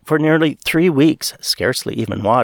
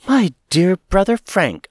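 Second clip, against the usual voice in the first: higher, bright with delight at seeing him; joyous cry; 8 semitones higher than usual. Acted. Woman greeting her brother-in-law in joyous surprise. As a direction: call out his name in glad surprise. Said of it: "I cried out joyously".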